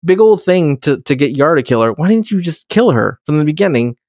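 high, incredulous demands; exasperated rant. Retold or imitated. A podcast host rants at Lutan in a high, incredulous voice. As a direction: hammer him with why, pitching up.

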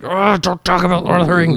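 high, blustering mock rant; hammed-up angry outburst; Jeremy, mock angry. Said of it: "He's so angry. Jeremy's like".

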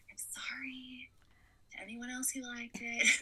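whispered apology, a little guilty; sheepish whisper. Real, not acted. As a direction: hush it like a confession. Apologizing to fans.

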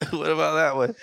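normal talking voice

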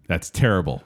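ordinary talk, nothing marked

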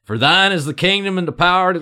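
high, loud, hammed-up Southern drawl; thick Southern drawl. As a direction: plow ahead in a thick Southern accent. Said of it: "the thickest Southern drawl".

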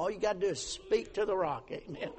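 normal talking voice

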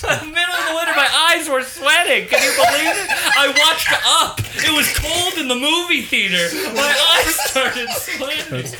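high teasing mock voice; laughing while mimicking a friend; mocking friend's excuse. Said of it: "Mason doesn't know what crying is".

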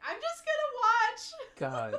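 high, loud, slow laughing imitation; mock timid quote. Teasing Katie about quitting D and D, high mock voice. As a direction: ham up a player bowing out. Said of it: "was like".

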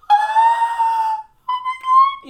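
high, loud squealed freak-out; mock fangirl scream. Imitating everybody freaking out over his goofy antics, high screaming. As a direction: shriek it like a swooning fan. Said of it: "everybody's like".

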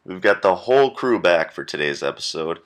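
loud announcer read, whole stressed; podcast intro read. Acted. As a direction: announce it to the listeners. Intro read versus live repeat.

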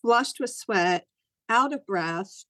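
ordinary delivery

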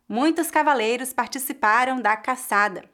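ordinary delivery

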